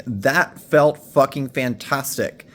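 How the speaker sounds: usual speaking voice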